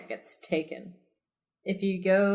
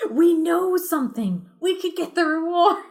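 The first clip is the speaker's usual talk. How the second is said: put-on high, giggly kid excitement; bubbling over with glee; excited; 10 semitones higher, 3 dB louder than usual. A narrator's reading. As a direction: Jack thrilled about the reward. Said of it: "He gets really excited".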